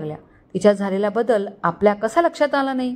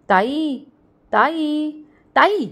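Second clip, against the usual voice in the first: insistent high-pitched call for sister; pestering younger brother's mild yell; calling out loudly; 6 semitones higher, much slower than usual. A narrator's reading. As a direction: call out higher with each repeat. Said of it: "Aarav जोरात ओरडला".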